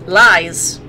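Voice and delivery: usual speaking voice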